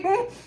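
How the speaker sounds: high laughing hum; a giggly knowing hum; amused hmm. Retold or imitated. Amused reaction to Bill's refusal.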